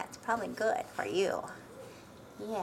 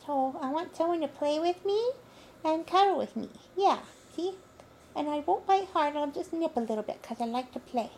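higher, louder puppy voice; voicing the dog; 4 semitones higher, 4 dB louder than usual. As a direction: speak for the puppy, high and eager.

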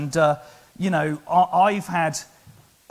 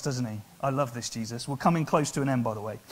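ordinary talk, nothing marked

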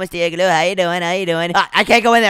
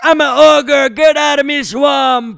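higher, louder, slow ogre growl of a line; Shrek voice; 6 semitones higher, 4 dB louder, slower than usual. Pretend. Kid playing Shrek to friend, slow ogre voice. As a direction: play the grumpy swamp ogre, slow. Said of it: "I got a Shrek".

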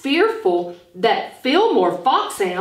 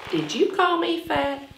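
higher, mock-indignant question; mock offended; 7 semitones higher, 3 dB quieter than usual. Teacher jokingly acting offended at the word. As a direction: pretend to be insulted.

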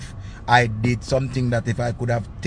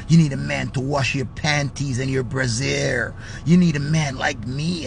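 put-on suave lover, pitched down; perfect-man act; 2 semitones lower, 3 dB quieter than usual. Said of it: "I go on like say "I'm the perfect man ever".

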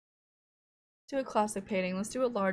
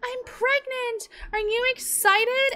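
much higher, slow, giddy wife voice; voicing the Sim wife; 4 dB louder, slower than usual. Sim wife waking Peter to share the news, high and giddy. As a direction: squeal it like an excited little Sim. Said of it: "She's like".

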